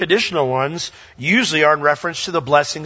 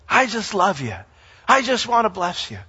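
tender Lord; 3 semitones higher than usual. Retold or imitated. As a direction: say it lovingly, gently. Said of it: "the Lord giving crowns back".

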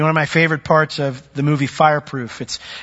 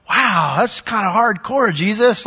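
taken aback; 4 semitones higher, slower than usual. Retold or imitated. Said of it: "you kinda go".

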